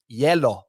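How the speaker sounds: "hielo" said as YEH-lo; Spanish-style Jell-O. Retold or imitated. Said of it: "your Spanish pronunciation of the word Jell-O".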